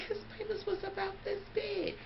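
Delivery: wide-eyed high hush; high hushed confession. Retold or imitated. Telling cousin, wide-eyed.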